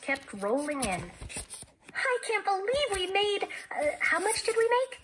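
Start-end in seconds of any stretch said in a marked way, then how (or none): 1.9-5.0 high-pitched, giddy squeal of disbelief; excited squeal. A narrator's reading. Megan to her friend back at the house, squealing with excitement. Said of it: "squealed Megan once they got back".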